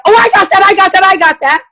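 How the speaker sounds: high, quick overlapping-style chorus of eagerness; eager party guests. Retold or imitated. Guests answering who has the product, eagerly imitated. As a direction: imitate keen customers jumping in, pitched well up. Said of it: "And then you always hear".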